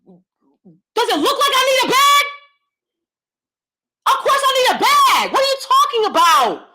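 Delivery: retelling a shouted outburst at the cashier over a bag; indignant outrage. Retold or imitated. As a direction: yell it at the cashier in disbelief. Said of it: "I said, I said".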